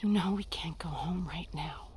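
low, very soft and breath-led; subdued, near whisper. Acted. Mother explaining to her son after shouting, hushed. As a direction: bring it right down after the outburst.

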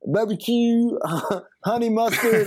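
high, imitated Baltimore counter girl; mock Baltimore accent, explaining to an idiot. Wendy's girl listing nugget sauces to customer, exasperated Baltimore accent. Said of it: "she's looking at me like I'm an idiot".

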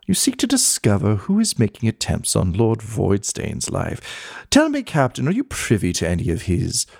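mannered society gossip with swooping pitch; skull gossip columnist, intrigued. Acted. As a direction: relish it like an old society columnist.

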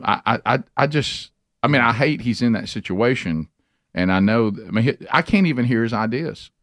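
ordinary talk

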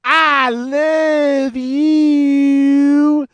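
high, loud, long-drawn preacher catchphrase; Brother Love. Retold or imitated. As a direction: stretch it out high like a hammy wrestling preacher. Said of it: "if they come out and say".

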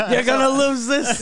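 mock salesman threatening a lost deal; mock pushy salesman. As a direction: pressure the clerk like a car dealer. Mock salesman pressure bit.

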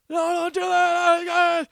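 high, slow, loud panicked shout; screaming no. Retold or imitated. Kidnapped boy begging them not to shoot, panicked scream. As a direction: scream it out in terror, stretched. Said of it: "this kid's screaming, crying".

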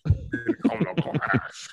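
slow villain voice, imitating Bane. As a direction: drag it out like Bane. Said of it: "You really adopted it".

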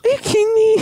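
very high loud squeal; shrieked catchphrase. Acted. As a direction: squeal the line in disbelief. Running bit, shrieked.

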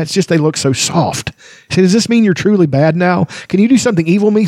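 high, squeaky kender asking in disgust. Acted. Kender waking to a nasty taste, high disgusted voice. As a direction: spit it out like a puzzled, grossed-out little kender. Tas begs Raistlin for magic.

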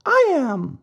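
a hint of a tiny cricket; lightly voiced cricket. Cricket answers Pinocchio.

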